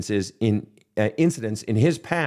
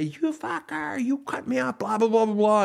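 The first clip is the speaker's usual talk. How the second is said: mock road rage; 11 semitones higher than usual. Counselor acting out a driver cut off, mock rage. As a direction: squeal at the driver who cut you off. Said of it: "more inclined to say".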